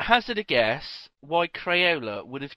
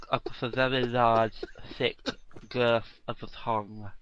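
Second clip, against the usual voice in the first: normal talking voice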